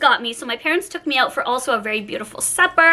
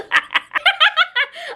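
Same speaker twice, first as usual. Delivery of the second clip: much higher giggle; real squeal of laughter. Real, not acted. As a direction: break into a giddy laugh. Laughing at herself.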